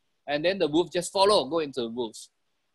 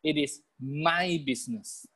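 measured declaration with a long "my"; stress on MY; 3 dB quieter than usual. Shown as a demonstration. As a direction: model the phrase, leaning on "my". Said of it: "Can you tell yourself or not?".

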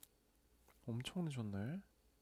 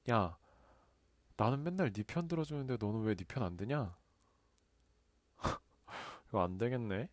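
ordinary talk, nothing marked

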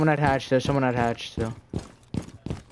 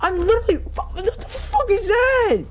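screaming in rage; 5 dB louder than usual. Real, not acted. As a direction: scream at the screen. Raging at game death.

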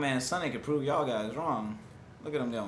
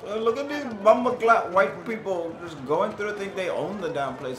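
mock African accent; 6 semitones higher, 5 dB louder than usual. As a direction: sneer at the tourists in a hammed-up African accent. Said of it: "I don't even know why I have that accent".

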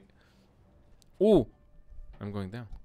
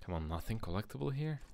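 quick, softer muttered question; muted questioning; 8 dB quieter, faster than usual. Real, not acted. Player searching a game room for items, muted questioning. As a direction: ask it under your breath, quickly.

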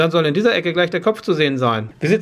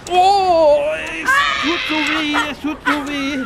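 crying out in real pain; 8 semitones higher than usual. Real, not acted. Bare feet in snow, pain.

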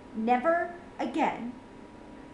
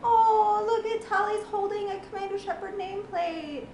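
tearful; 7 semitones higher than usual. Real, not acted. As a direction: hold back the tears as you say it. Upset at the final scene.

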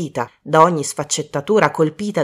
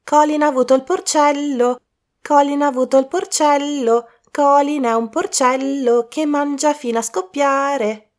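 mocking singsong; 9 semitones higher than usual. Bridget mimics Colin over his pig, mocking singsong. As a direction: chant it to tease him. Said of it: "si mise subito a fargli il verso".

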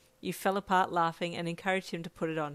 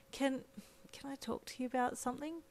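soft, high, hesitant request; tentative; 7 semitones higher, 9 dB quieter than usual. A narrator's reading. Friend broaching a delicate subject, soft and tentative. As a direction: ask carefully and quietly.

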